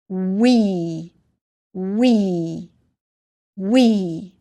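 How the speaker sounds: We drawn out as "weee"; long vowel sound. Shown as a demonstration. Teacher reading a short word for learners, long vowel. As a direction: hold the long "ee" in We. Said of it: "will make the long sound E".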